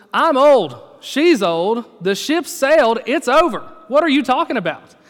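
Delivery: put-on old priest scoffing at an angel, exasperated and loud; exasperated old man. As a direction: throw up your hands in disbelief. Zechariah's exasperated response.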